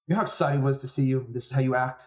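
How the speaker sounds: scolding parent. Acted. Parent scolds the daughter for walking out, indignant.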